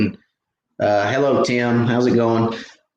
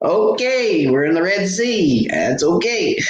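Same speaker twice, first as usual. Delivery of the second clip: high, laughing mock reassurance; amused mock calm; 8 semitones higher, slower than usual. Telling viewers about the splash, high laughing mock calm. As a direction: shrug it off with a giggle. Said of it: "I was like".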